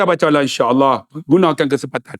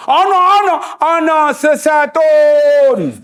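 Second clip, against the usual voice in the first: desperate fake Arabic; 6 semitones higher, slower than usual. Lost uncle pleading in fake Arabic.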